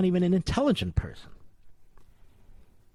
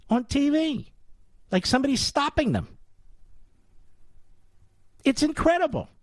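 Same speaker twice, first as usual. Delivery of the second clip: rising indignant rant; 8 semitones higher than usual. Real, not acted. Ranting about TV hosts.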